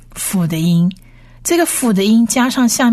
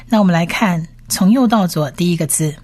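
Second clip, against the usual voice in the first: ordinary talk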